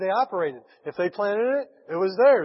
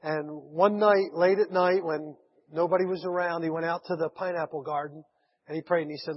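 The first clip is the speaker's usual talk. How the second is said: usual speaking voice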